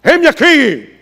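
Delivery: loud, high, booming heroic cry; mock-heroic shout. Shown as a demonstration. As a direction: proclaim it like a bold hero volunteering. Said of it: "en un tono heroico".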